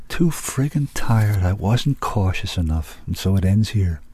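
ordinary delivery, nothing marked

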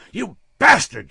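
loud, high, slow furious curse; raging scream. Acted. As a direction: rage at him. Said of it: "she raged".

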